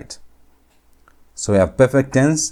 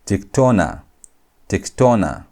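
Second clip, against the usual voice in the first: tiktona, emphasis on "tona", falling at the end; stress on TONA. Shown as a demonstration. Teacher demonstrating what accents show, stress on "tona" in tiktona. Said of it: "show you where you put emphasis".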